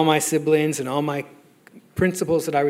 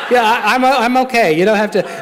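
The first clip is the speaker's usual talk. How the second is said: higher and louder, laughing through a nervous brush-off; laughing protest; 6 semitones higher, 5 dB louder than usual. Retold or imitated. To his sponsor, stopping the overshare: laughing and high. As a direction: wave him off while laughing, a bit panicked.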